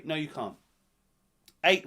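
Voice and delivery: usual speaking voice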